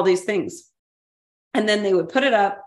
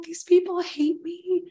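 retold sobbing; 9 semitones higher than usual. To husband on the phone after reading mean notes, high tearful imitation. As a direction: pitch it up and let the words wobble like crying. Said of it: "And I was sobbing".

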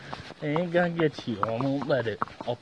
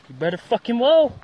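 louder angry scolding; 3 semitones higher, 6 dB louder than usual. Real, not acted. Owner scolding the dog.

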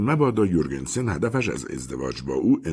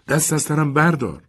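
higher, petulant outburst; stamping anger; 10 semitones higher, 3 dB louder than usual. A narrator's reading. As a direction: snap it while stamping a foot. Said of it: "پا به زمین کوفت و گفت".

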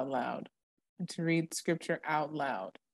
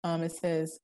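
usual speaking voice

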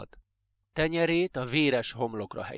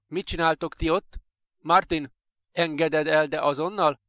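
raised, sharp demand; higher, louder scolding voice; stern intervention; 4 semitones higher, 5 dB louder than usual. Acted. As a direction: order the bully to let go. Teacher breaks up the bullying.

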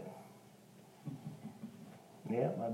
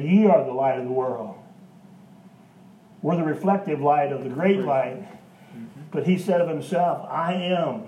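loud, lifted pulpit voice; preaching at full force; 3 semitones higher, 4 dB louder than usual. Real, not acted. Preacher declaring God's words to the congregation, loud. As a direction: proclaim it to the church.

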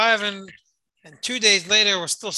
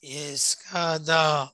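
drawn out, slow and heavy; slow Kaddish; 3 semitones lower, much slower than usual. Retold or imitated. Old mourner saying Kaddish, very slow. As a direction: stretch each syllable like a grieving old man. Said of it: "saying the words really, really slowly".

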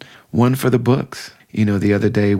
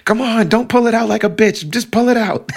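much higher bossy imitation of a tough friend; injured friend orders the doctor on, high bossy imitation; imitating Julie; 13 semitones higher than usual. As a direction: demand it high and impatient. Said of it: "what I remember her saying is".

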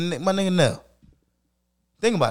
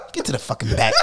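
lower, put-on bossy voice; low mock-haughty command; mock snooty order; 7 semitones lower than usual. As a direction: play the smug first-class passenger. Mock order to economy passengers.

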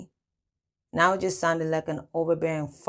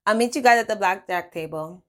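higher, louder and breezy; announcing to the group; 4 semitones higher, 7 dB louder than usual. A narrator's reading. Telling the men where to meet, bright projected call. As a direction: project it cheerfully to the whole group. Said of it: "I turned and faced the men".

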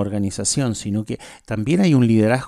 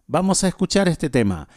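brighter, livelier radio host read; radio announcer; 3 semitones higher than usual. Acted. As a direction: present the song like a DJ. Radio host introduces a song. (locutor de radio animado)

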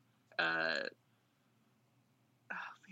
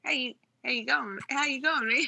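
higher, halting attempt at an Australian accent; struggling Australian; 6 semitones higher than usual. Guest practises the greeting before cracking up, struggling Australian accent. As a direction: stumble through a mock Australian greeting. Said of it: "I'm struggling".

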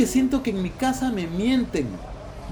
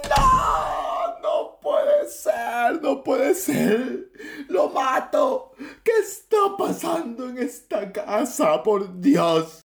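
crazed shouting. Acted. Father loses it over family betrayals, crazed shouting. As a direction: scream to the heavens like a madman. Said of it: "grita como loco".

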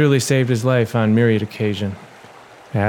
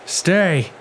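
higher, urgent yell; 7 semitones higher than usual. Acted. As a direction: yell at him to stay alive. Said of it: "he yelled".

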